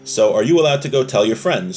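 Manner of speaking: ordinary talk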